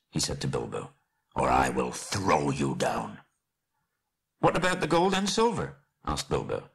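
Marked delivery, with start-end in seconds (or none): 1.4-3.2 low, slow menacing dwarf; deep-pitched deliberate warning; grim threat. Acted. Thorin threatens Bilbo. 4.4-5.8 high-pitched little hobbit voice; small high questioning voice; hobbit's query. Acted. Bilbo asks Thorin timidly.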